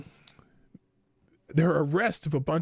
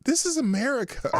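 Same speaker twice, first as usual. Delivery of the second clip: high, laughing through the quoted line; giggling mock of the clip. Quoting the clip while laughing.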